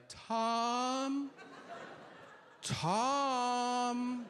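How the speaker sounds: high, pleading call of the wife's name. Retold or imitated. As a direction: cry out his name like she's hurt. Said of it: "she starts calling me out by name".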